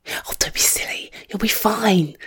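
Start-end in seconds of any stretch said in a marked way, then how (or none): none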